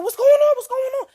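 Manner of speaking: high, loud worried asking; alarmed questioning. Retold or imitated. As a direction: demand an answer in a panic. Mother asks her scared daughter.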